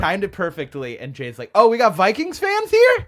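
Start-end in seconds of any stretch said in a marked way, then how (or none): 1.6-3.1 high, loud shout to a crowd; calling out to crowd. Retold or imitated. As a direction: work the crowd like a hyped host.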